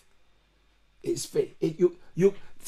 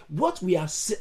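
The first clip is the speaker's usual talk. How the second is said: normal talking voice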